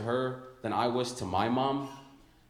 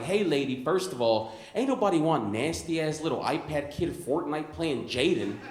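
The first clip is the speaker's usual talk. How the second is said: loud indignant rant; telling her off; 6 semitones higher, 4 dB louder than usual. Retold or imitated. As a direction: scold the mom, building louder. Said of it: "so I was like".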